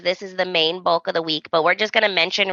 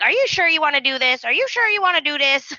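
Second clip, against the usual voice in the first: mock needling; 6 semitones higher than usual. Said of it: "He's ridging him".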